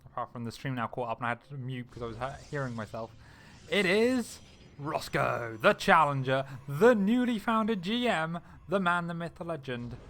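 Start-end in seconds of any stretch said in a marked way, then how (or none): 3.7-10.0 booming fight-night introduction; ring announcer. Pretend. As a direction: draw it out like a boxing announcer. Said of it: "I don't know why I'm calling him the Scottish Fire".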